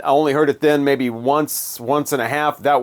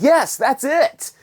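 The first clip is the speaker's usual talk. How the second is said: high, slow gush of approval; imitated delighted producer; 11 semitones higher, slower than usual. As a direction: act out a producer thrilled with the take. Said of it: "her producer was like".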